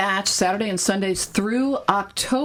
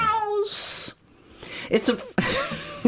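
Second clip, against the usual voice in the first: laughing; much slower than usual. Real, not acted. Breaking up over Meows.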